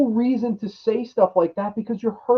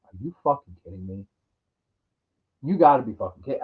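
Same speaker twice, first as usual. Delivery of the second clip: fast, much softer incredulous mutter; muttered disbelief; 11 semitones lower, 5 dB quieter, much faster than usual. Real, not acted. Grumbling to viewers about drones, fast and soft. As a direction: mutter it like you can't believe it.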